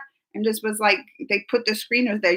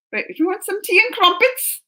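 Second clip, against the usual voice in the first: high-pitched mock British; attempted British accent; 8 semitones higher than usual. Offering tea to show off Britishness, mock British accent. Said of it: "I wish I could talk like a British person".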